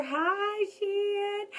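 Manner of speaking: bright high hello to someone in the room; a sudden high hi to someone nearby; high greeting. Real, not acted. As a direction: call out a high, light hi.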